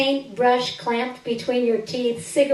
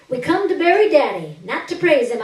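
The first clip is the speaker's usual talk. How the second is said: higher and louder, grandly declaimed; mock eulogy; 6 semitones higher, 7 dB louder than usual. At the cardboard coffin, eulogizing Daddy: loud mock-solemn proclamation. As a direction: proclaim it like a mock funeral oration.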